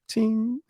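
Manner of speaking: high, ringing "ting"; cartoon-style high sparkle noise; imitated sparkle ting. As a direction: voice a little gleam.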